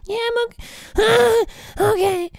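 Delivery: hammed-up squeaky reply; squeaky employee voice. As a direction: play a meek little worker in a tiny high voice. Voices the employee answering the boss.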